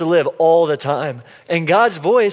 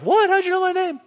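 high, astonished squeak; much higher voice of a baffled stranger; amazed stranger; 14 semitones higher than usual. Retold or imitated. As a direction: play the stunned stranger who hears her name. Stranger amazed at her name.

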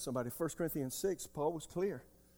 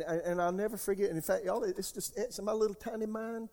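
normal talking voice

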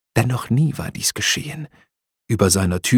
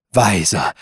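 ordinary delivery, nothing marked

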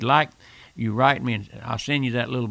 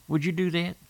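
ordinary talk

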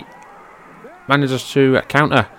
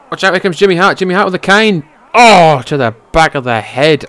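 higher, louder alarmed commentary; excited call; 5 semitones higher, 5 dB louder than usual. Real, not acted. Commentator warning of Jimmy Hart with the cane, excited. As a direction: sound the warning as he runs in.

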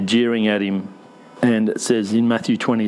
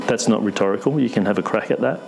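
usual speaking voice

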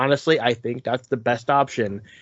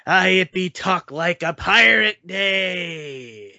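slow, drawn-out pirate talk; 4 semitones higher, slower than usual. Acted. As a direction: ham it up like a pirate captain. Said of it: "I cannot keep the pirate voice going".

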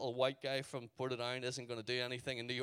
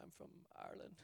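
small, barely voiced reply; timid near-whisper; 16 dB quieter, faster than usual. Answering a stranger who stops him at the door, timid near-whisper. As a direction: say it under your breath, meekly.

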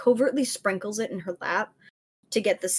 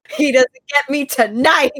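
loud, gleeful boast; triumphant; 6 semitones higher, 12 dB louder than usual. Real, not acted. Player celebrating beating the sleep spell, loud and gleeful. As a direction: crow about the win.

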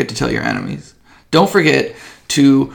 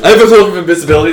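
loud, rapid mimicking of a player; mocking echo; 4 semitones higher, 9 dB louder, much faster than usual. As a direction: parrot the claim back in mockery. GM mockingly echoes player's claim.

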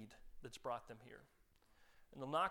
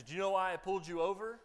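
police officer; 3 semitones higher, 6 dB louder, faster than usual. Retold or imitated. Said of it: "he says".

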